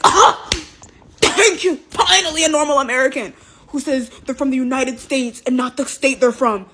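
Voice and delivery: high, hammed-up gasp of gratitude settling down; exaggerated thankful outburst; mock relief. Acts man's exaggerated relieved gratitude.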